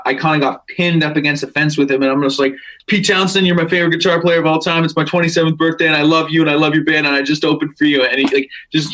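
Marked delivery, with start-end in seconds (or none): 2.9-8.0 fast, higher-pitched starstruck babble; gushing verbal garbage. Retold or imitated. Starstruck fan cornering guitar hero, gushing in a rush. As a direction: blurt it in one breathless-fan rush. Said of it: "just verbal garbage coming out of my head".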